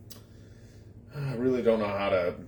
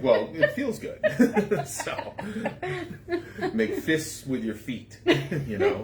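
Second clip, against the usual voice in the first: laughing; 11 semitones higher than usual. Real, not acted. Laughing through story, laughs heard.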